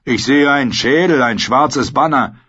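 rough-and-ready mocking companion; amused sellsword Bronn. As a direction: tease the boy, then call out what you see.

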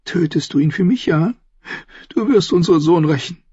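high-pitched woman's voice, demanding revenge; pleading Cersei. Acted. Sister urging her brother to avenge their son, high pleading voice.

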